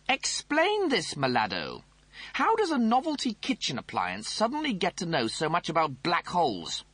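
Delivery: high, snooty officer voice; pompous Rimmer voice. Acted. Rimmer interrogates the toaster.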